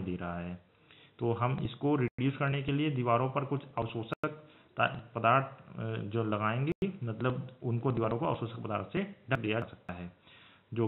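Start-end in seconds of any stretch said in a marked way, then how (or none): none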